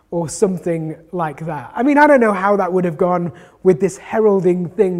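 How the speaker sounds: usual speaking voice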